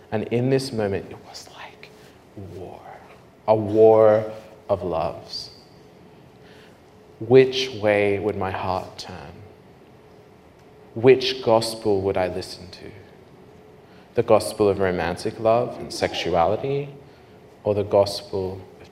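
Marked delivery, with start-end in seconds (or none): none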